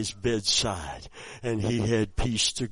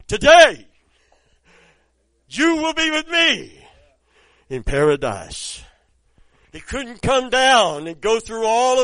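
much higher, louder fiery preaching; full-force preaching; 11 semitones higher, 9 dB louder than usual. Acted. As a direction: proclaim it to the whole church.

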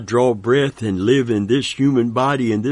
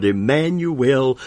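ordinary delivery

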